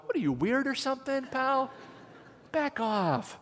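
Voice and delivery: high-pitched mock indignation; mock rebuff. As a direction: play an offended stranger pushing someone away. Imagined offended rebuff from woman.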